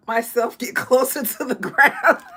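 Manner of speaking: laughing through the words; giggling out the line. Real, not acted. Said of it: "laughing while speaking".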